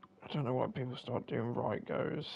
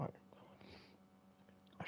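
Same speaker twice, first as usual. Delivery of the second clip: whisper; 19 dB quieter than usual. Real, not acted. Whispered aside about surname.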